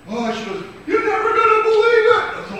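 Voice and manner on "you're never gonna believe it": put-on high-pitched, loud childish cry; girl shouting her bad news home; high anguished shout. Distraught girl announcing bad news.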